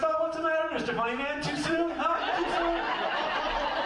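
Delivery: high, teasing wife voice; taunting wife. Retold or imitated. Wife taunts her husband at the kitchen table, high and gloating. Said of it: "She walks up to the table and says".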